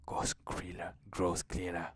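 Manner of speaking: plain reading voice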